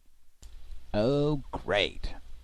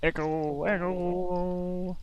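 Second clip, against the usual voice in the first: echo effect; 13 semitones higher, much slower than usual. Pretend. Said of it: "Could you hear me echoing?".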